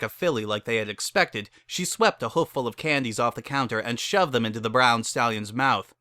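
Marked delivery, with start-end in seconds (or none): none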